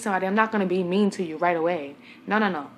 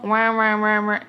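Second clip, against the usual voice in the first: muffled-adult "wah-wah" noise, louder; Charlie Brown's mother; 6 dB louder than usual. Retold or imitated. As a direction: imitate wordless, droning grown-up talk. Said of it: "sound of Charlie Brown when his mother talk".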